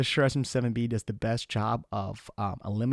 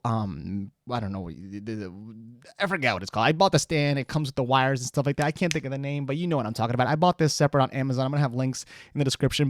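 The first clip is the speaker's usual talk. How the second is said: usual speaking voice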